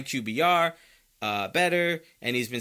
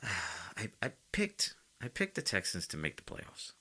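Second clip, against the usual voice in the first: soft, hushed confession after a sigh; sigh, then admit it under your breath; sheepishly low-volume admission; quietly; 4 semitones lower, 11 dB quieter than usual. Real, not acted. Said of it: "I'm gonna say it quietly".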